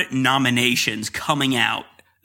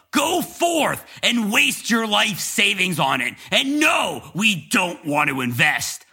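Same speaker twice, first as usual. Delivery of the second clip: over-the-top announcer; 7 semitones higher than usual. Acted. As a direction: sell it like a cheesy infomercial. Hammed-up fake podcast intro.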